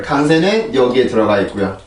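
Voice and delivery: usual speaking voice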